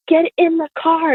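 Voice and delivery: high-pitched imitation of a panicked dad; mock panic, voice jumping up; freaked-out dad. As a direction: order them like a dad freaking out. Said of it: "My dad freaked out".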